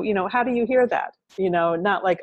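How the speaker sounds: ordinary talk, nothing marked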